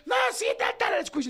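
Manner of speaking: chillido agudo de discusión; griterío imitado. Retold or imitated. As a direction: imita el desmadre con voz muy aguda. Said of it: "fue un desmadre". (alboroto chillado)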